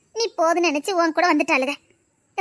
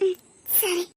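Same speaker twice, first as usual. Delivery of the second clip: soft, tearful murmur; crying; 8 dB quieter, much slower than usual. Acted. Grieving woman accepting the elders' comfort, crying. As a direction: sob out a small yes.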